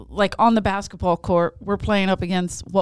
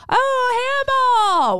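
squeaky, much higher nervous answer; nervous high pitch; 4 dB louder, slower than usual. Retold or imitated. Woman answering the stranger, nervous and very high. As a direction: squeak it out, caught off guard. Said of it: "with, like, the highest pitch 'cause I was so nervous".

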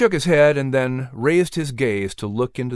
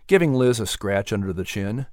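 plain reading voice

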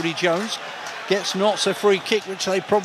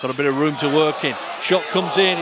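normal talking voice

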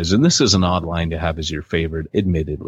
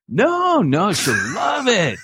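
imitating Pete; 12 semitones higher, slower than usual. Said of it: "Pete said".

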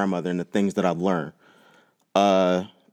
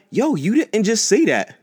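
much higher and slowed, can't believe it; jaw-dropped disbelief at grandma; high, drawn-out stunned disbelief; disbelieving shock; 12 semitones higher, 3 dB louder, slower than usual. Retold or imitated. Grandmother admits yelling in front of date.

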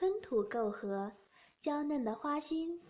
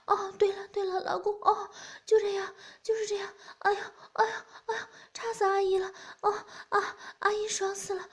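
a much higher, louder voice, breathless ecstatic cries; high loud moaning woman; 10 semitones higher, 5 dB louder, faster than usual. Acted. Middle-aged woman to her lover, high loud ecstatic moaning. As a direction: cry out high and loud, lost in passion. Woman character in erotic scene.

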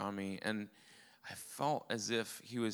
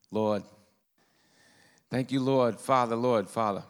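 loud Rhode Island accent demo; short, loud bursts of Rhode Island accent; 9 dB louder than usual. Shown as a demonstration. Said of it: "That's my Rhode Island accent".

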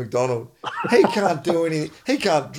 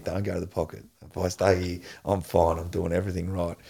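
inner voice; 7 semitones lower, 6 dB quieter than usual. Retold or imitated. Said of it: "I've got Neil's words ringing in my ear".

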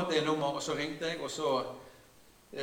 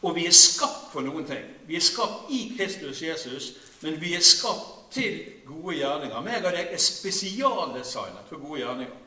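full-force preaching; 3 semitones higher, 8 dB louder than usual. Acted. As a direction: drive it home to the congregation. (kraftfull forkynnelse, høyt og langsomt)

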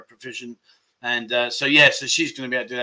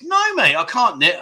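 indignant protest; 5 semitones higher than usual. Real, not acted. Rejecting the knitting jibe.

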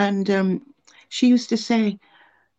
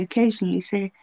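normal talking voice

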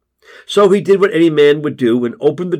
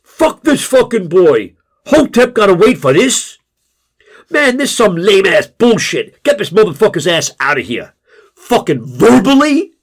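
loud, high furious shouting; angry outburst; 7 semitones higher, 6 dB louder than usual. A narrator's reading. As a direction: roar it like an erupting crowd. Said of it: "The room erupts".

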